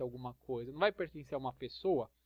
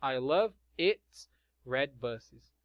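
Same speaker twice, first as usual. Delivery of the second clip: slow and even, "its" made clear; slow careful example sentence; slow model sentence; 3 semitones lower, much slower than usual. Shown as a demonstration. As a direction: read it slowly for the class. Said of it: "model sentence".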